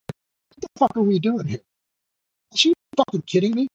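high-pitched incredulous outrage, retold; indignant rant without extra volume; losing it. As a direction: re-enact an outburst, pitch jumping up. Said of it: "I started losing my shit".

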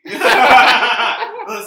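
loud, high burst of laughter; big real laugh. Real, not acted. Teller cracking up at his own embarrassing story, big laugh. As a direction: crack up hard.

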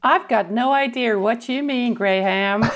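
put-on Australian accent. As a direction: answer as a drawling Australian. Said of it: "that's a good Australian accent".

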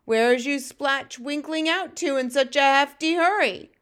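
loud, high-pitched mocking giant; booming laugh into a drawn-out taunt; Fleshlumpeater, jeering. A narrator's reading. Bully giant taunting the runty one, jeering loudly.

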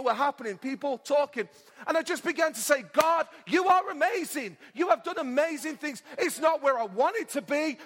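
lifted, emphatic sermon delivery; fervent preaching. Real, not acted. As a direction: preach blessing over the congregation with rising and falling fervour. Preaches with fervour.

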